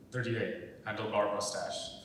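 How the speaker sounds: ordinary delivery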